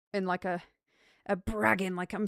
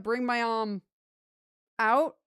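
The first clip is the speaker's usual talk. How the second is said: usual speaking voice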